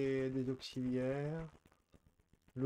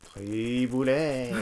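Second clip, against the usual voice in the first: very slow stretched name; drawn out; 2 semitones lower, 7 dB louder, much slower than usual. Real, not acted.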